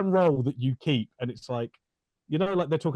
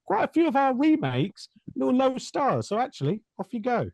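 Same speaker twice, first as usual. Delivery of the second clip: higher-pitched mock official turning someone away; lightly voiced jobsworth, pitched up; politely dismissive pretend gatekeeper; imagined Disney official; 6 semitones higher than usual. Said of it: "they're like".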